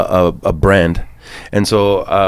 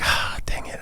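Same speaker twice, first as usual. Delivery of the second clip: breathy whispered sigh; whispered groan; 12 dB quieter, slower than usual. Retold or imitated. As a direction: mutter it under your breath. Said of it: "And I'm like".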